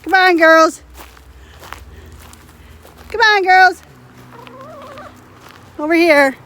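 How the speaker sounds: high, loud sing-song call; calling chickens. Real, not acted. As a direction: coax the hens over.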